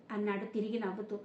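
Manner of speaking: usual speaking voice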